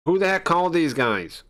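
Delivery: high, loud demand of who called them; an inspector furious at intruders, pitch way up; angry shout. Acted. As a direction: bark it out in outrage.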